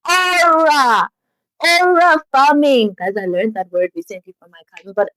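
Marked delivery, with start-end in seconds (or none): 0.1-2.9 high, drawn-out exclamation of admiration; hyped cheer. Real, not acted. Hyping up Sarah buying every bag, excited. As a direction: call it out high, stretching the vowels.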